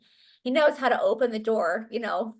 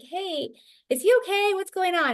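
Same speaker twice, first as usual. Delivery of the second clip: higher-pitched worried question; concerned check-in; 9 semitones higher than usual. Retold or imitated. Mother on a work call asking daughter about crying son, concerned. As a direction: anxiously check on someone.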